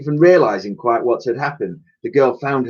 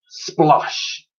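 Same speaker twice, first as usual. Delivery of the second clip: splash sound; 4 semitones higher than usual. A narrator's reading. Narrator voices splash.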